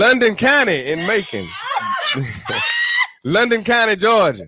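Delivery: loud, high, laughing through the words; shouting it out with laughter. Real, not acted. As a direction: crack up while saying it. Laughing joke about London County.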